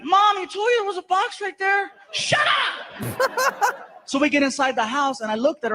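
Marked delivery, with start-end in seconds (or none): 0.0-3.8 put-on much higher child's voice, half laughing; kid scolding mom. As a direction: tease like a cheeky boy. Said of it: "Even Frankie was like".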